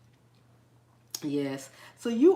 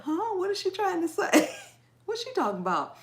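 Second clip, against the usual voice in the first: higher mock-baffled listener; puzzled viewers; 9 semitones higher, 3 dB louder than usual. Host imitating people who don't understand her, mock puzzled. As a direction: imitate a confused viewer, pitched up. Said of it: "People be like".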